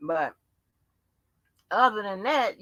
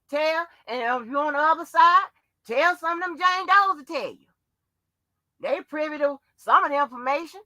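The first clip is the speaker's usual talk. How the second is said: higher, louder furious rant; angry rant; 7 semitones higher, 3 dB louder than usual. Real, not acted. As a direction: tear into the critics, swearing hard. Furious rant at online critics.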